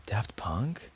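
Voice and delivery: breathless amazement at the name; awed gasp. Retold or imitated.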